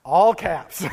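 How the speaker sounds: normal talking voice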